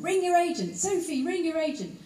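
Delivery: high, urgent voicing of a scrawled message; urgent notes. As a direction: read it out like a nagging note with exclamation marks. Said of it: "with more and more exclamation marks".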